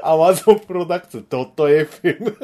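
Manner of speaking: announcer call broken by laughter; mock radio jingle, laughing through it; laughing announcer parody. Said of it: "かっこ悪い".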